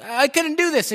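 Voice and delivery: loud, very high, excited outburst; thrilled disbelief. Retold or imitated. Preacher voicing the healed man showing off his hand, thrilled. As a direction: imitate someone bursting with amazed joy. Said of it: "filled with excitement".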